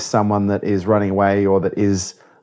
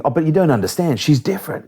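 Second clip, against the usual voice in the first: addict voice; 6 semitones higher than usual. Retold or imitated. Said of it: "the addict voice rationalizing".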